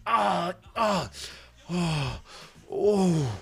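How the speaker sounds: drawn-out pained ahs and ohs; hammed-up moans of pain; mock moaning. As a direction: moan theatrically between pauses. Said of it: "you gotta be weak to moan".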